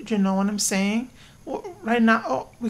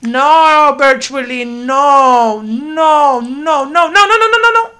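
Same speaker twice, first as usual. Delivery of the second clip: louder and higher with each no, a frantic yell; 4 semitones higher, 9 dB louder than usual. Real, not acted. Yelling at the pet wrecking her lot in a panic. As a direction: panic more with every no.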